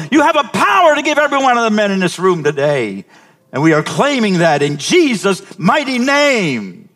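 loud, high full-force prayer; fervent preaching prayer. Real, not acted. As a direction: build into a shouting prayer.

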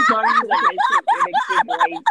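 high, loud, laughing mock of a friend; mocking imitation. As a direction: parrot her words in a laughing, high squeal. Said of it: "She sounded so stupid".

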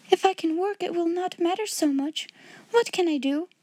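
high, eager question; hopeful girl. A narrator's reading. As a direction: ask as a child suddenly relieved. Girl relieved, eager to work.

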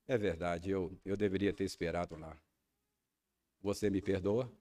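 wife calmly asking her husband's forgiveness; calm, gentle reply. Retold or imitated. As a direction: answer gently and humbly. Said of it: "e de maneira calma".

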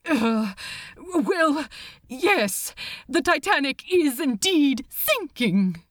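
hesitant start, then high confession; nervous admission. Acted. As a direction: reluctantly admit bad news to a superior. Caught out by stern superior.

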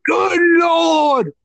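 bad British commentator impression, very high; hammed-up, drawn-out astonished cries in mock British; Nigel McGuinness. British commentator exclaiming at a wrestling bump, badly imitated astonishment. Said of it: "what's the worst Nigel McGuinness impression ever".

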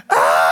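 sudden yelp, startled and loud; a short scream jolting him awake; wife's scream. Retold or imitated. Wife wakes husband at three a.m., screaming. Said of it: "and she is just".

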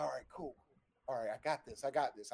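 quiet, steadying himself; quiet self-talk. Retold or imitated. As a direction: murmur it to yourself to stay calm. Stoned, psyching himself up.

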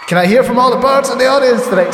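high, projected stage call; mock arena shout-out to the audience; imagined concert star. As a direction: work the crowd like a singer on stage. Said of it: "he was going on and said".

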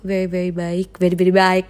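gushing South Jakarta code-mixing; Jaksel slang. Pretend. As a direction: gush it like a Jaksel kid. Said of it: "Gila, gue kayak anak Jaksel aja".

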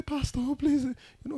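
high-pitched mock pleading; pitying plea. Member pleading with pastor for struggling lady, mock plea. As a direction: lightly imitate someone begging the pastor.

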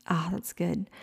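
soft, whispered aside; near-whispered appreciation; hushed savouring. Real, not acted. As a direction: murmur it to yourself, savouring it. Savouring the verse she read.

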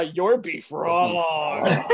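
very slow, laughing a little; slow deadpan. Retold or imitated. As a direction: drag it out slowly. Friends' reply quote.

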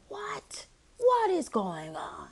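high hushed "what", then a slow stretched whisper; retold stunned whisper; disbelieving whisper. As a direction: whisper it slowly in mock disbelief. Retold reaction to another interruption.

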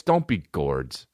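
ordinary talk, nothing marked

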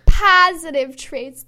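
put-on slow, loud Chicago accent. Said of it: "Good Chicago accent there".

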